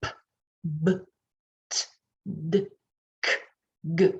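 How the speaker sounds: P, b, t, d, k, g 를 모음 없이 짧게 끊어; 깔끔하게 끊은 자음만; clipped clean sounds. Shown as a demonstration. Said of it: "we clip our sounds".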